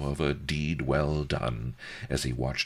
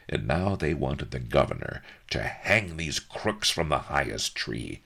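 usual speaking voice

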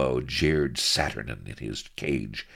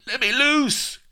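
very high, loud yell; piercing little dwarf's outcry; struggling dwarf; 8 dB louder than usual. A narrator's reading. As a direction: shriek to be released. Struggling dwarf demands release.

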